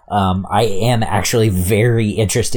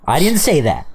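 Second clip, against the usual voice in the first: mock denial; 5 semitones higher than usual. Mock denial after insulting cousin's brother.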